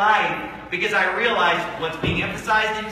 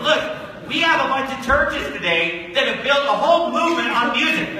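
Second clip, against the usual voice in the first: ordinary talk, nothing marked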